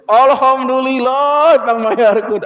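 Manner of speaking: loud, high, drawn-out cry of thanks; hammed-up joy at being rescued; mock jubilation. Debtor hearing a relative will pay the bill, jubilant.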